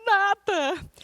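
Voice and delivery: high acted tearful denial; mock crying. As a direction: act a weepy, high-pitched brush-off. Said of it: "que eu tava chorando".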